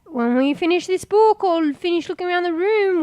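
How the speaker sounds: usual speaking voice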